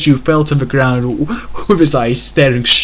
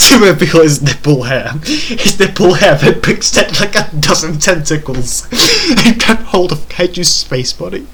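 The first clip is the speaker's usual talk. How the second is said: higher-pitched, voice cracking with laughter; laughing while reading; 4 semitones higher than usual. Real, not acted. Reader describing nipple hair turning into tentacles, barely holding in laughter. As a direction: struggle to read on through the giggles.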